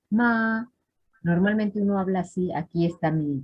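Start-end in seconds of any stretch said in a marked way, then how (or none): 0.1-0.7 "ma" held high and level; high first tone. Shown as a demonstration. Teacher modelling the high tone for students, high level "ma". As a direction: keep "ma" up on a steady high pitch.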